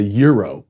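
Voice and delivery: "gyro" as YEE-roh; counterman's pronunciation. Retold or imitated. Host quotes the guy behind the counter, YEE-roh. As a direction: begin "gyro" with a Y sound. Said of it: "that's how he pronounced it".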